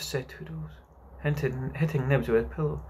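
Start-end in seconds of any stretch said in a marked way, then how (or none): none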